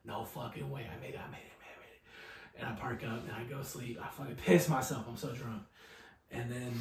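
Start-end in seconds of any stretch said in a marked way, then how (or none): 0.9-2.6 relieved whisper. Retold or imitated. Drunk driver relieved arriving home.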